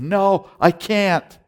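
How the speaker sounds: high, loud, slow cry of refusal; inner scream. Retold or imitated. Preacher's spirit screaming no to going to heaven. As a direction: stretch out a desperate no. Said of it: "but it screamed".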